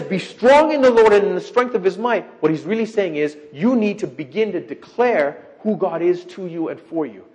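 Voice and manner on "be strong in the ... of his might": loud, higher declaiming quote; scripture quote. Retold or imitated. Preacher quoting the verse to his congregation, loud and higher. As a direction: proclaim the verse. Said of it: "when he says".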